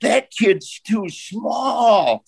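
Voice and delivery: slow, high Texas twang rising to a shout; Texas drawl. Retold or imitated. Old coach griping about a small recruit, slow Texas shout. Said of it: "this Texas voice going".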